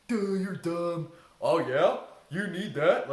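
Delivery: loud mocking inner critic; mocking rebel voice. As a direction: jeer like a bratty rebel. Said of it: "that voice of".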